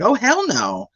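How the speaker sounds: high, comic refusal; emphatic refusal. Retold or imitated. Dog rescuer asked to grab the chihuahua, high emphatic refusal.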